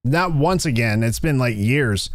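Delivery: ordinary delivery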